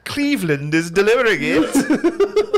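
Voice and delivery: high, slow, laughing villain rally; rally the crew like a cartoon villain; mock Nazi commander. Nazi commander rallying his sub crew, high mock villain.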